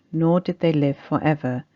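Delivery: ordinary talk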